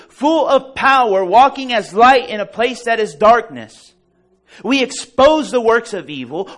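high, loud building sermon climax; full force preaching. Acted. Preacher rousing the congregation, loud and high. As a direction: preach it out, rising and ringing.

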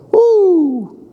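high joyful woo; a preacher's happy whoop; excited whoop. Real, not acted. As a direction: let out a shout of joy. Preacher exults after verse.

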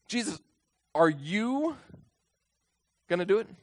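"you" stretched and rising high; stress on YOU. Shown as a demonstration. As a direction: lean on "you", lifting it into a question. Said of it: "do you see what I'm emphasizing here".

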